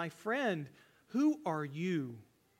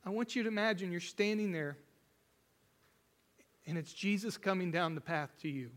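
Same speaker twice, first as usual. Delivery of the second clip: emotional. Real, not acted. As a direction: let the feeling catch in the words. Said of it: "I'm gonna get emotional here".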